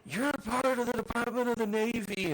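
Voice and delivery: high, loud barking order from a mock officer; mock Navy yell. Navy officer yelling at the Marines, mock shout. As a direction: yell it like an officer scolding his recruits. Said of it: "Navy yelling at the Marines".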